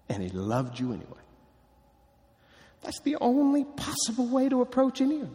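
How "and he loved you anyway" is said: soft and tender. Acted.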